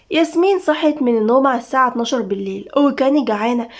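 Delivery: ordinary delivery, nothing marked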